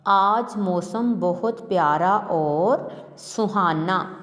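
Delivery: very slow, word by word dictation. Shown as a demonstration. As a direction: read it out slowly for children to write.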